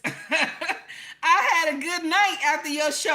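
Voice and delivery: laughing while recalling a good night. Real, not acted. Laughing as she answers viewer comments.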